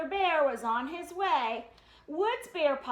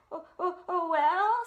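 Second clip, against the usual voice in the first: stammering; 4 dB louder than usual. Acted. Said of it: "stammered Brother".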